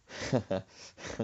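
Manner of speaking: mama's chuckle, acted out; retold laughing. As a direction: imitate her laughing to herself. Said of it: "starts laughing".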